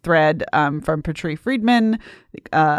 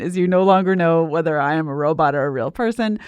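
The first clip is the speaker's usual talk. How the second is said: usual speaking voice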